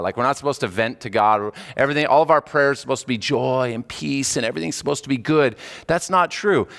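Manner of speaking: normal talking voice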